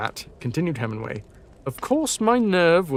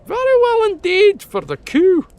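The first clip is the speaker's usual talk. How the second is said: higher, louder, puffed-out praise as MacLeod; puffing MacLeod; 6 dB louder than usual. Acted. MacLeod praising the hunter, puffing and hearty. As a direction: puff the praise out hearty and high. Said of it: "puffed MacLeod".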